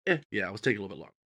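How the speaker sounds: low, soft and quick mumbled concession; quiet, hurried shrug of a line; offhand admission. Retold or imitated. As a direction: toss it off under your breath.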